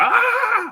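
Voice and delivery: high, loud open-throated scream; retold yell. Reenacting waking from sleep paralysis, high loud yell. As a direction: scream it out to break free. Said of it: "I just yelled".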